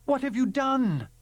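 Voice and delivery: alarmed. Acted. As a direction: demand it in alarm. Alarmed question to Rule.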